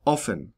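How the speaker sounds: offen with a short British-style O. Shown as a demonstration. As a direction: say offen with a quick rounded O. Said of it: "short O pronounced the British way".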